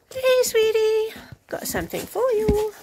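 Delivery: high sing-song coo to a pet. Real, not acted. Owner greeting her horse with a treat, high cooing baby talk.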